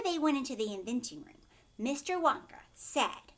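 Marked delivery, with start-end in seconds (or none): none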